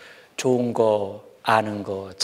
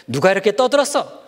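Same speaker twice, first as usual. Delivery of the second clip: louder, higher stern demand; scolding teacher; 7 semitones higher, 5 dB louder than usual. Acted. Teacher scolding a noisy class, louder and higher. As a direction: bark it like a teacher at a noisy room. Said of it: "선생님이 시끄러운 교실에 들어와서".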